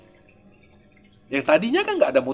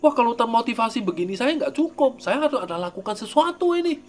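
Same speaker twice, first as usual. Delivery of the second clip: eager inner voice; 8 semitones higher than usual. Retold or imitated. Voicing eager practitioner's thoughts.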